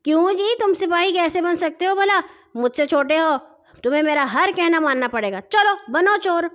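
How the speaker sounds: domineering bully voice; glaring anger. A narrator's reading. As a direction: order the smaller boy about angrily. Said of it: "لال لال آنکھیں نکال کر بولا".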